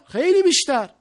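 loud, high push on خیلی; forceful emphasis. Real, not acted. Repeats the call for more effort. (تأکید محکم و بلند روی «خیلی»)

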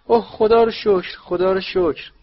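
high, loud cry of relief, voice breaking through; relieved shout. Acted. Mother shouting as her family comes home, high and loud cry of relief. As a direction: shout your thanks to heaven at the top of your voice. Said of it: "صدای فریاد خانم ویزلی".

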